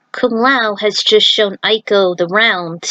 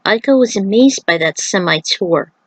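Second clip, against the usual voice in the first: usual speaking voice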